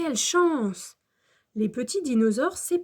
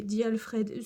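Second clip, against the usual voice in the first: ordinary delivery, nothing marked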